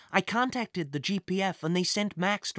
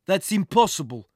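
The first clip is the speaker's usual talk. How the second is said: gruff villain; 6 dB louder than usual. A narrator's reading. Villain denies boy's claim.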